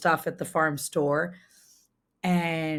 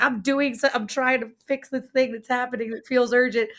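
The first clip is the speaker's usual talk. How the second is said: higher pitched, urgent retort; replaying her own stressed comeback, pitched up; wife defending herself in a silly spat, higher and urgent; defensive self-quote; 6 semitones higher than usual. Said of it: "And then I was like".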